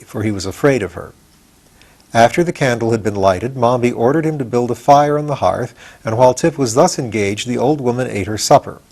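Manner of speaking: ordinary delivery, nothing marked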